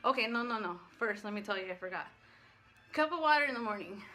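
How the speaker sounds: ordinary delivery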